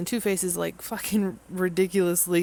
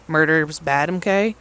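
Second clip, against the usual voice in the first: mock Bruce Wayne; 8 dB louder, slower than usual. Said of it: "Bruce Wayne's like".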